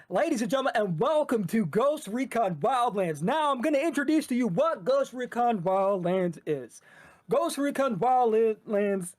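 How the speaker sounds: put-on shoutcaster presentation voice; shoutcasting voice. Shown as a demonstration. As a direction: introduce the game like a match caster. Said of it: "in your shoutcasting voice".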